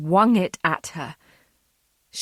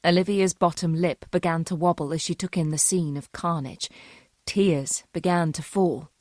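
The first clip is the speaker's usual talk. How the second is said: plain reading voice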